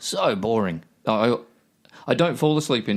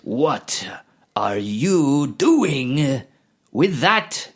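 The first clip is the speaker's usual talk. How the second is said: slow, halting word-by-word line read; reading aloud haltingly; 5 semitones higher, 4 dB louder, much slower than usual. Retold or imitated. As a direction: stiff actor reading each word separately. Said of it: "Like he's trying to read it word by word".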